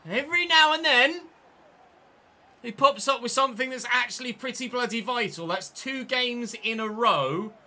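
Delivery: loud, high elated ranting; excited celebration. Real, not acted. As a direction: celebrate the late winner. Late winning goal celebration.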